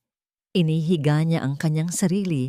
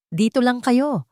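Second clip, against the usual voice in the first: put-on sharp raised shout to the kids; shouted order; 7 semitones higher, 4 dB louder than usual. Miguel orders the children to stay put, shouting. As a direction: bark the order over the storm. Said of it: "sigaw ni Miguel sa mga bata".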